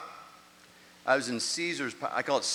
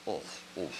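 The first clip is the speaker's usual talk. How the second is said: much softer, low moaning oh; groaning misery; 7 semitones lower, 5 dB quieter than usual. Retold or imitated. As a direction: hangover groan in the heat. Hangover hits him.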